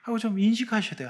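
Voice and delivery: usual speaking voice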